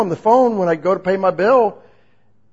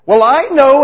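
louder, higher indignant "Well, I know"; more defensive; 9 semitones higher, 11 dB louder than usual. Retold or imitated. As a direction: push back harder. Believer answering God defensively.

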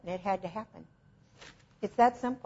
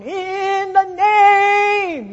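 drawn-out, much higher, loud sung-out cry; mock screaming healer; 11 semitones higher, 12 dB louder, much slower than usual. Preacher mocking loud healers at demons, hammed-up drawn-out scream. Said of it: "you gotta scream at demons".

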